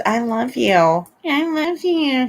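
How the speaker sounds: slow, put-on lovey voice; mock declaration of love, climbing high; mocking imitation. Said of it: "And he goes".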